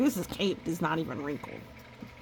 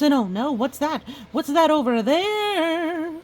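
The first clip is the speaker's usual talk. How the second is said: put-on higher, louder oh no to children; mock alarm; 8 semitones higher, 7 dB louder than usual. Reader pointing out danger in the picture, high and loud. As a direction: gasp out the alarm.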